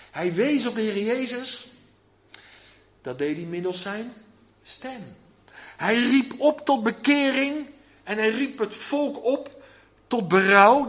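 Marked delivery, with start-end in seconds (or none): 5.8-10.9 luider en trager, oproepend; roepende prediker. Acted. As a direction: roep het volk op. Prediker roept met verheffing. (luid oproepend als een prediker)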